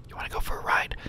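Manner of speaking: hushed whisper, voice dropped away; conspiratorial whisper. Retold or imitated. Bert leaning over, whispering a ride offer to guest. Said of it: "he kinda leans over and says".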